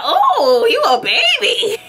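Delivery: high-pitched amused teasing. Retold or imitated. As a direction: coo it mockingly at someone much younger. Teasing a younger man.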